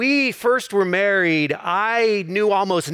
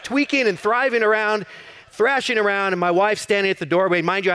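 ordinary delivery

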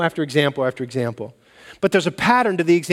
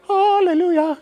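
sung-out high hallelujah, much higher; mock pious hallelujah; 13 semitones higher than usual. Preacher mocking what nobody says after hitting a thumb, high hallelujah. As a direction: exaggerate a saintly cry of praise.

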